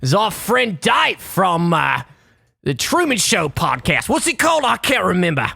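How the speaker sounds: podcast intro in a cartoonish Australian accent; Nick Kroll's Australian accent. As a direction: exaggerate a silly Australian accent. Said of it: "it's a ludicrous version of an Australian".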